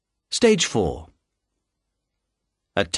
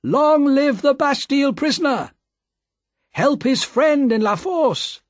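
crowd shouts; 14 semitones higher than usual. A narrator's reading. The Paris crowd cheers the Bastille prisoner, shouting. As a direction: rally cry of the mob. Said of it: "He heard shouts of".

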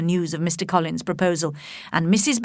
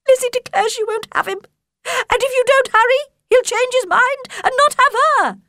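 much higher, agitated mother voice; a flustered mother begging for help, pitched up; fussy Mrs Bennet; 4 dB louder than usual. Acted. Mrs Bennet pleads with husband.

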